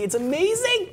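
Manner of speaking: high-pitched gush of delight; thrilled, sing-out high rave. Real, not acted. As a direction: gush about it, voice leaping way up. Gushing over a game deal.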